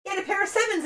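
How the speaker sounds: high, outraged protest at losing; exasperated loser's squawk; indignant outburst. Real, not acted. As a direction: cry foul at the bad call. Loses hand to pair of sevens.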